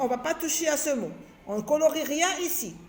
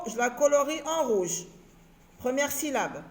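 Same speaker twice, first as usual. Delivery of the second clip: ordinary talk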